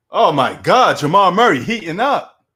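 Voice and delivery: loud, hyped sports-fan outburst; excited exclamation. Real, not acted. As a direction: exclaim loudly at a player's hot streak. Exclaims at a basketball player's streak.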